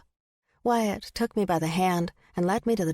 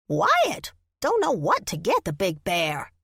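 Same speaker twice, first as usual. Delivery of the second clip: squirrel voice. Acted. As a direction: squeal the name out. Squirrel character voice.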